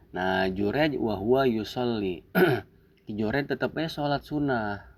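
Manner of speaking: ordinary talk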